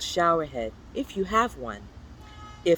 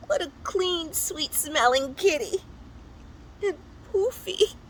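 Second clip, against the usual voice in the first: much higher, delighted cooing; high sweet admiring coo; cooing character voice; 13 semitones higher than usual. Acted. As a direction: fuss over the fluffy cat. Character coos over clean kitty.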